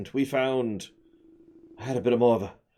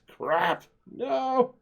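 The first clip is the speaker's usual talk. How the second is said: frustrated yelp. Real, not acted. Realises can't build.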